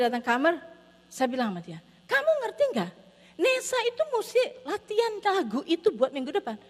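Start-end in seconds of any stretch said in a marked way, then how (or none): 2.1-6.6 high-pitched, insistent parental lecture; retold heated telling-off; exasperated scolding. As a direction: press the point on a stubborn child. Parent lectures crying child, retold.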